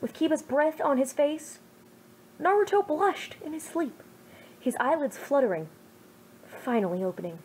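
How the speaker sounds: ordinary delivery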